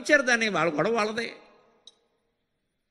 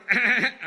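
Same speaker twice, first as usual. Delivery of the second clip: husband's giggle; 4 semitones higher than usual. Retold or imitated. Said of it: "కిసకిసమంటే".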